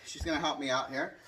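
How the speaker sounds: normal talking voice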